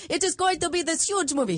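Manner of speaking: put-on Sicilian accent. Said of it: "I'll do his, his accent".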